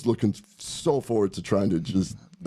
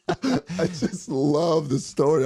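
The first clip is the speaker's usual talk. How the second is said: laughing; 7 semitones higher, much slower than usual. Real, not acted. Laughing at son's tennis story.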